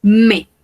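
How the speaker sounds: "Me" said as plain me, no S sound; final S silent. Shown as a demonstration. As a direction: di "Me" sin la S final. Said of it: "Recordemos que no pronunciamos la S". ("mes" suena "me", S muda)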